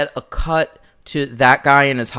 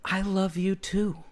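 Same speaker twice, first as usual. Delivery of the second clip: soft, tender reply; sincere, unironic; 3 semitones higher, 10 dB quieter than usual. Retold or imitated. Donald answering his friend, soft and sincere. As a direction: answer gently, in full earnest. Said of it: "no hint of irony".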